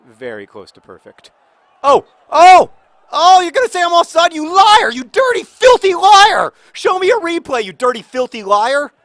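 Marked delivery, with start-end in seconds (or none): 1.8-8.9 high, loud outraged yelling at the referee; furious shout. Real, not acted. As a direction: scream at the ref, accuse him of lying. Yelling at referee over offside.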